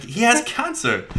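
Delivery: high, loud, drawn-out retort; mock exclamation. Rami answering the rant at the church, high mock exclamation. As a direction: shoot back in a high imitated voice. Acting out Rami's reply to rant.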